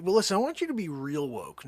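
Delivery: usual speaking voice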